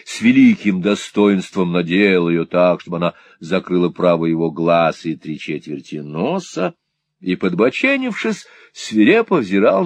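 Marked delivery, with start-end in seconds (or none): none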